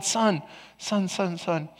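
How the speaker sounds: very soft, fatherly God voice; gentle calling. Retold or imitated. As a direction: gently hush a protesting son.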